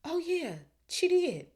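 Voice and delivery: high, put-on agreeing voice; forced agreement. A narrator's reading. Harry and Ron nudged to back Hermione, forced agreement. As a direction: agree in a squeaked-up voice.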